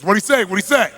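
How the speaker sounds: impatient boss. Retold or imitated. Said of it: "turns to the interpreter and says".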